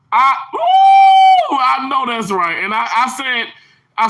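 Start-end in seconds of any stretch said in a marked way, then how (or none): 0.6-1.6 very high, loud "ooh"; excited whoop. Real, not acted. Streamer agreeing heartily, excited high whoop.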